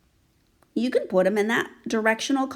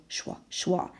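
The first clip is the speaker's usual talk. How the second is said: unvoiced schwa, schwa like wings; whispered wing flap; 6 dB quieter than usual. Shown as a demonstration. Teacher whispers the wing sound for children. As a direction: breathe it like flapping wings. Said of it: "his wings go schwa".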